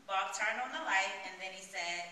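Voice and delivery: ordinary talk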